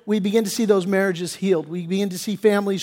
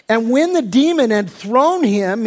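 ordinary delivery

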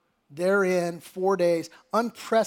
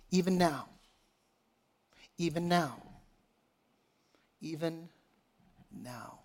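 softer and softer, sinking almost to nothing; hushed repetition; 3 semitones lower, 8 dB quieter than usual. Real, not acted. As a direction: let each repeat fade into a reflective hush. Preacher repeats phrase for reflection.

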